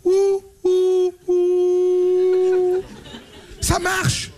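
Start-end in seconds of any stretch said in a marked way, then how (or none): 0.0-2.8 ghostly wail. Acted. Imitating a ghost.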